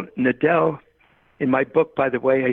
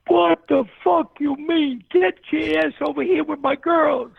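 Dick Vitale; 13 semitones higher than usual. Retold or imitated. Angry dad ordering the coach over to his girls, imitated yell. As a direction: imitate an indignant holler, pitched way up. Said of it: "he yells out at me".

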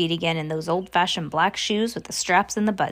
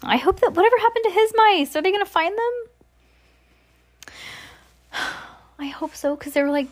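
worried; 11 semitones higher than usual. Real, not acted. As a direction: ask with real concern. Worried about the mice.

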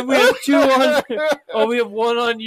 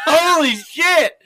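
shocked shout; 6 semitones higher, 4 dB louder, slower than usual. Real, not acted. Shocked at gross answer.

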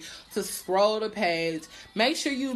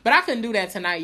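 ordinary talk

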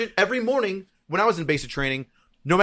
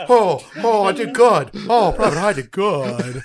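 goofy higher voice, pleased with himself; proud dumb recruit; 4 semitones higher, 6 dB louder, slower than usual. Retold or imitated. Recruit boasting to drill sergeant, goofy voice. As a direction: play the clueless proud private. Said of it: "And I was like".

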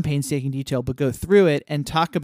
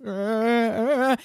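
nervous stammer; 6 semitones higher, slower than usual. Retold or imitated. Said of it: "immediately starts losing it".

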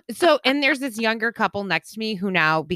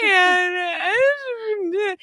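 high, slow sob breaking through; crying; 11 semitones higher, much slower than usual. Real, not acted. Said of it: "are you crying?".

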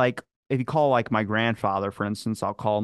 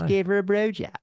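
higher-pitched Baltimore demo, fronted O in "bro job"; pitched-up Baltimore accent; 8 semitones higher, faster than usual. Shown as a demonstration. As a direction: show off the Baltimore "bro jab". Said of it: "Baltimore accent".

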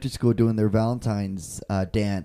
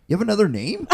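lightly voiced puzzled daughter, higher pitch; daughter's questioning; 8 semitones higher, faster than usual. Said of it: "She's like".

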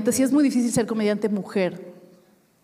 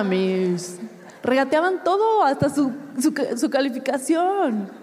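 normal talking voice

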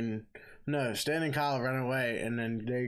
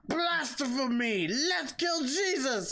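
much higher, slow hammed-up cry of blasphemy; mock outrage; 13 semitones higher, slower than usual. Assistant demands Jesus be killed, slow hammed-up outrage. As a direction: drag out the outrage like a cartoon zealot. Villain voiced in retelling.

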